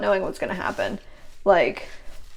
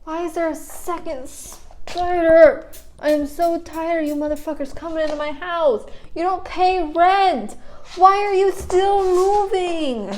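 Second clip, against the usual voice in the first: distressed whine; 8 semitones higher than usual. Real, not acted. Vlogger scolding spiders in the house, high distressed whine.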